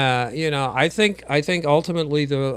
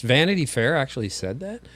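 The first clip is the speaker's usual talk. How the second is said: ordinary talk, nothing marked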